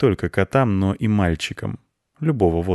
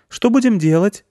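put-on high, louder child's voice; businesslike girl; 13 semitones higher, 5 dB louder than usual. A narrator's reading. Anya asks the boy for plans, businesslike. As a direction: ask briskly in a high girl's voice. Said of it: "деловито спросила Аня".

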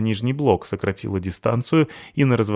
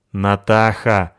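warning; 5 dB louder, much slower than usual. Acted. Кэп одёргивает.

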